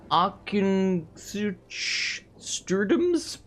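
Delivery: Arkinsuch Sturdums tried out slowly, rising, unsure; unsure pronunciation. Shown as a demonstration. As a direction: sound out Arkinsuch Sturdums hesitantly. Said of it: "I don't know how you say that".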